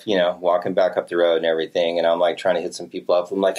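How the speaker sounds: normal talking voice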